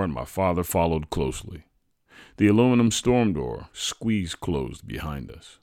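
ordinary delivery, nothing marked

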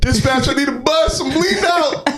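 mock dying cop on radio; bleeding out. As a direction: overdramatic wounded radio call.

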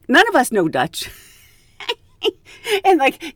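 ordinary delivery

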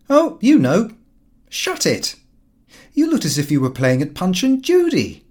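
mock him lightly, a bit above him; mocking Lupin.

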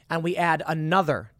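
ordinary delivery

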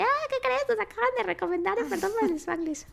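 high, thrilled announcement; excited. Retold or imitated. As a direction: burst out with happy news. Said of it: "le dije a Mariana así de".

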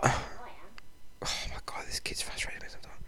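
exasperated whisper under the breath; whispered aside. Real, not acted. Aside about frustrating kid.

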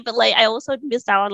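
ordinary talk

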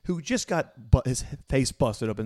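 ordinary talk

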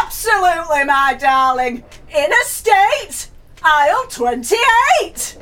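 high, slow and loud; loud booming answer. Acted. Loud bingo voice in library.

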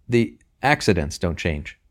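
slow, with a small stumble on "accidents"; slight stumble. A narrator's reading. Boy answering the baker's question, stumbling a little on "accidents". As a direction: hesitate slightly before getting out "accidents". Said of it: "stumbling a little on the word accidents".

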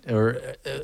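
normal talking voice

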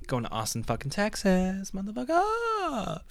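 rising high, drawn-out whoop; hyped celebration. Acted. Man announcing Austin move, mock-triumphant rising cheer. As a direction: climb to a held high note on the last word.

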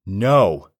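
loud, high cry of defiance; refusing the entity with a shout; defiant yell. Acted. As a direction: yell no back at it. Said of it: "I yelled in defiance".

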